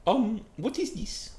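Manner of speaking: very high, puzzled character voice; hesitant high question, slowing down; confused. Acted. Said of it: "Italy is confused and says".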